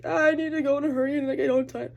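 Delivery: playing her past self in a rush; flustered rush. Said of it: "'cause I was like".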